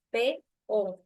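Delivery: PE and O said as two separate beats; syllables separated. Shown as a demonstration. Teacher shows the class the vowels separate, P, O in split syllables. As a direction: split P from O with a short break. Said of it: "se separen".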